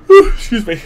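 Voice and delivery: talking through a yawn; words stretched by a yawn. Real, not acted. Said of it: "Sorry, I'm yawning a bunch".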